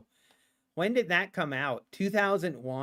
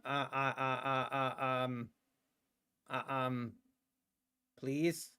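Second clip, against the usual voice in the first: lower, much softer hesitant pleading; speaking softly; 6 semitones lower, 10 dB quieter than usual. Acted. Streamer coaxes the market to move, softly pleading. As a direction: coax it gently, barely above a breath. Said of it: "speak softly to the market".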